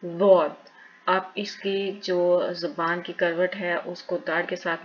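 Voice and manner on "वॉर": वॉर said loud and full, mouth puffed; heavy puffed letter; heavy Arabic letter. Shown as a demonstration. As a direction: fill the mouth with air on वॉर. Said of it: "सबसे मुश्किल वाला word है".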